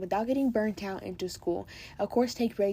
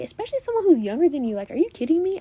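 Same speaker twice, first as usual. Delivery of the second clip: higher, louder burst of indignation; indignant disbelief; 7 semitones higher, 8 dB louder, faster than usual. Real, not acted. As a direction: say it as if you can't believe the nerve. Indignant about an older girl's rudeness.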